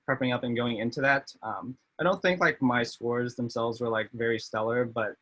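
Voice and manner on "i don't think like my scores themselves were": ordinary talk, nothing marked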